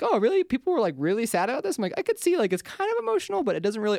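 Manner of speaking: retelling a past self who is sure the film won't make her cry; smug disbelief. Retold or imitated. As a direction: play it cool and doubtful, voice up high.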